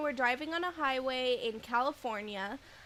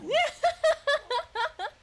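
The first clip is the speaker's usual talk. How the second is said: high, loud burst of laughter; laughing hard; 8 dB louder than usual. Real, not acted. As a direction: crack up helplessly. Cracks up at own joke.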